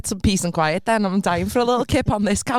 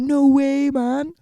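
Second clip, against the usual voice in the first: put-on higher, louder Jamaican-accented exclamation; 5 semitones higher, 3 dB louder, much slower than usual.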